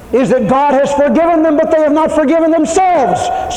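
rising sermon crescendo; preaching at full force. Acted. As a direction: hammer home that God knows. Preaching climax.

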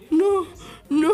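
sobbing no, no, voice breaking; pretend-crying at the sad video; mock crying. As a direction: cry it out like you just got the twist. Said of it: "Y yo así".

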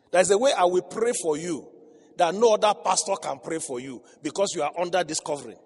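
loud emphatic preaching; forceful sermon delivery. Acted. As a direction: drive the point home to the congregation.

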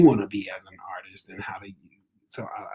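breathy whispered aside, fast. Real, not acted. Host musing softly to her guest, whispered. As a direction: hush it down to a quick whisper.

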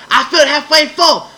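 high, loud shrill protest; imitating a huffy woman insisting; mock indignant customer. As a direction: squeal it like an outraged customer.

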